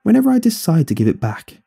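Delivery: high, sing-out retort; calling out. A narrator's reading. Mother to son from the hallway, calling out. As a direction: toss it back from down the hall. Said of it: "she called out from the hallway".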